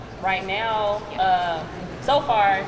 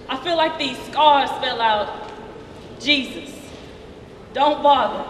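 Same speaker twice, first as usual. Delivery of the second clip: higher, emotional spoken-word delivery with weighted pauses; impassioned slam poetry; 7 semitones higher, 4 dB louder than usual. Acted. Performs own slam poem on show.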